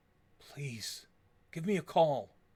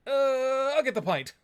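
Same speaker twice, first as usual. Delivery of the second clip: flustered dodge; 10 semitones higher, 8 dB louder, slower than usual. Acted. Awkward dodge after age jab.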